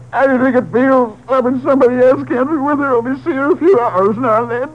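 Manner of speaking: breaking down. Acted. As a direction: voice cracking into sobs, pitch rising. Husband breaks down confessing.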